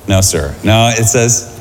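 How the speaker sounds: normal talking voice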